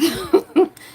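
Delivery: short breathless laugh; a quick laugh while out of breath. Real, not acted. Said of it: "Sorry, I'm really out of breath".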